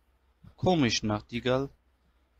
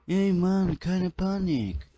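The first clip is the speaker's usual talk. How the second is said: mock Udo voice; 6 semitones higher, slower than usual. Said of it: "ich bin Udo".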